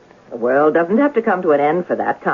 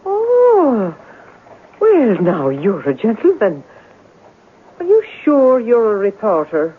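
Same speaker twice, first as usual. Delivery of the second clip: sudden sweetness; 4 semitones higher, slower than usual. Acted. Landlady turns coy.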